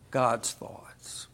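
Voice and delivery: whispered aside, breathy and hushed. Real, not acted. Preacher whispers an aside to the congregation. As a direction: drop to a whisper for the gloss. Aside inside scripture reading.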